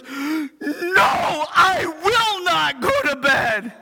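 put-on high, defiant child voice; a daughter's high refusal of bedtime. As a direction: refuse like a stubborn kid. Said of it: "she goes".

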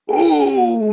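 high, loud drawn-out "oh"; startled cry. Acted. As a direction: gasp out loud at the monster. Mouse meets the real monster.

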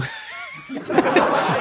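high breathy giggle; short amused laugh. Real, not acted. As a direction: crack up after the impression. Laughs at own dialect imitation.

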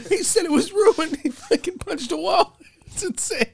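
very high, tearful falsetto; hammed-up weepy woman through laughter; crying date. Date telling friends about the dinner, mock crying in falsetto. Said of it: "She's, like, crying".

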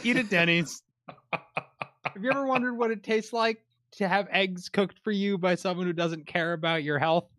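hammed-up commercial announcer; mock ad read. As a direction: sell it like a cheesy ad. Mock Denny's sponsor ad.

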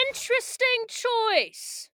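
high, slow, drawn-out otherworldly voice; mysterious booming voice. Acted. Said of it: "It's a huge booming voice".

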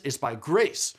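"grace" picked out with firm emphasis; stress on grace. Shown as a demonstration. Teacher pointing out the emphasized word, stress on "grace". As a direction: land hard on "grace" at the end. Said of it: "You hear the emphasis?".

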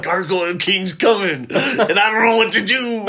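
high, loud, laughing panic voice; panicked conspiracy nut. Retold or imitated. Dilton panicking about the Gargoyle King, high and laughing. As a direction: squeal it out like a frantic crank. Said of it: "He's like".

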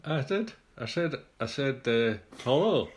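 high squeaky voice through a grin; squeaky ventriloquist dummy. Pretend. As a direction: talk without moving your lips. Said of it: "You shook your head and didn't say anything".

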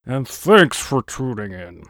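loud, booming patriotic announcer; jowly voice. Acted. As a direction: sign off like a jowly statesman. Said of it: "That was a very patriotic voice you had there".

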